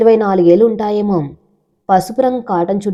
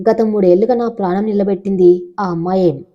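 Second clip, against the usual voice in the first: ordinary delivery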